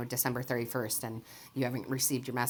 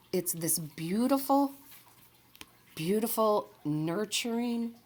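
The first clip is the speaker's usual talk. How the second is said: tender gushing; 8 semitones higher, slower than usual. Real, not acted. Gushing over the nurturing love.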